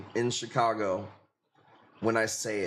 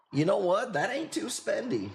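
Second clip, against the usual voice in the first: mock casual customer; 7 semitones higher than usual. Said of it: "Would you be like".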